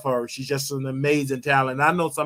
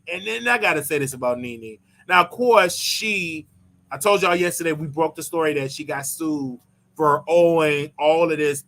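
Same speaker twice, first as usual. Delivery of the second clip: ordinary delivery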